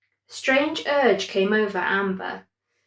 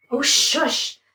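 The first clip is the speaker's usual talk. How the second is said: snapped; 6 semitones higher than usual. A narrator's reading. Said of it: "snapped Amber".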